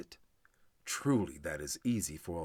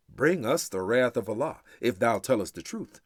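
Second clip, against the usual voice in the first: louder, higher defiant taunt; taunting challenge; 3 semitones higher, 7 dB louder than usual. Acted. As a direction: throw the dare back at him mockingly. People taunting the prophet.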